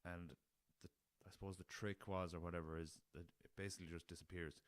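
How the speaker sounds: ordinary talk, nothing marked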